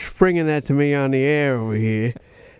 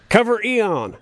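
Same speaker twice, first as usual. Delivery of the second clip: "Cover Eon" with a southern twang, Eon said EE-on; southern accent; 7 semitones higher, slower than usual. Retold or imitated. Host slips into a southern accent quoting the heroine, southern accent. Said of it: "I've drifted into a southern accent".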